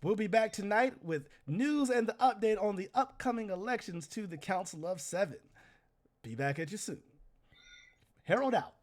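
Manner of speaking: loud, high broadcaster flourish; hammed-up herald sign-off. Herald closing his broadcast to the city, loud and high. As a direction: wrap the show up with a boom.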